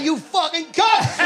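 high, slow mock yell of outrage; a playful fake-furious shout at a fan; mock outraged shout. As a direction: bellow it like a jokey insult. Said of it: "He went".

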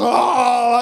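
high, loud running cry; high comic howl; panicked wail. Retold or imitated. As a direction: howl while fleeing. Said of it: "like this".

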